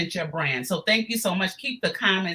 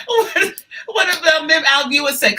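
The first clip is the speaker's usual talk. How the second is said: laughing, louder and high; laughing shout; 9 semitones higher, 8 dB louder than usual. Real, not acted. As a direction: shout through laughter, thrilled. Excited reaction to viewer comment.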